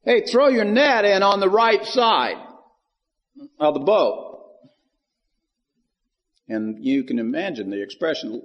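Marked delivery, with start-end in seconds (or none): 0.0-4.4 calling out. Retold or imitated. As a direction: shout the instruction out to the boat. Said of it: "And so he says".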